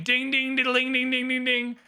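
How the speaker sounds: high, even ding-ding on one note; imitated bell ringing. Streamer ringing a shame bell, high monotone imitation. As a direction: ring the shame bell with your voice.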